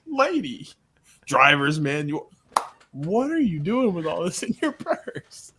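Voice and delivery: laughing through each item on the list. Real, not acted. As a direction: crack up while recalling a funny scene. Laughing while recalling a comedy scene.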